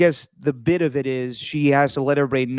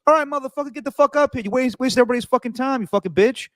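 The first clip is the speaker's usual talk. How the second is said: mock yelling server; 11 semitones higher, faster than usual. Hot dog stand server insulting a nervous customer, high mock yell. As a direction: hurl insults like a rude hot dog counter woman. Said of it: "the woman just goes".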